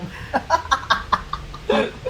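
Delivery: soft, airy cruel giggle; quiet, high breathy laugh. Acted. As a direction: giggle at your own cruel plan. Partner laughs at cruel idea.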